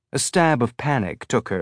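usual speaking voice

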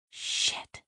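whispered curse under the breath; inward whisper. Acted. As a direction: hiss it to yourself, barely voiced. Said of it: "Sidra inwardly flailed".

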